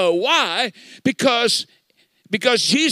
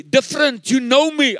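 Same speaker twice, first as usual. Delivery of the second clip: higher, louder imitated insistence; retold emphatic insistence; insistent retold claim; 5 semitones higher, 4 dB louder than usual. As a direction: act out her pressing the point. Retold woman insisting he knows her.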